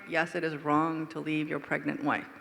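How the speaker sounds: ordinary talk, nothing marked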